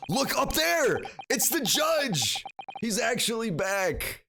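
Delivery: high excited shouting. Real, not acted. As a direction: point it out thrilled. Excited spotting the judge.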